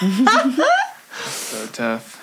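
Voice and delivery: high, very slow drawn-out. Real, not acted. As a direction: drag the words out high. Repeats the phrase differently.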